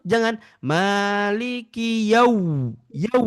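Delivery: yau pushed hard; forced yau. Shown as a demonstration. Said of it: "Bukan begitu". (yau dipaksakan)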